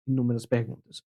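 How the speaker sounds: ordinary delivery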